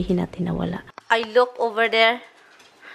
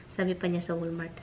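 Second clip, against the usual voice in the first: usual speaking voice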